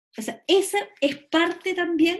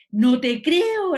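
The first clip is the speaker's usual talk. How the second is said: loud, drawn-out, higher-pitched disbelief; exclaimed surprise; 4 semitones higher, 8 dB louder, much slower than usual. Shown as a demonstration. Teacher voicing a WhatsApp message full of exclamation marks, exclaimed surprise. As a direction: stretch it out as a big exclamation of surprise. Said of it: "la sorpresa que le genera".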